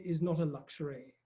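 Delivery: ordinary delivery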